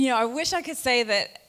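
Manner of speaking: ordinary delivery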